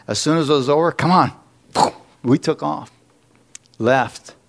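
normal talking voice

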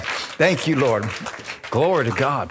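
ordinary delivery

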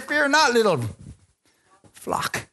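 fear not up high, then flock whispered; quoting Jesus. Acted. Preacher giving Jesus' comfort to the flock, high then whispered. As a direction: start way up, sink to a whisper on flock.

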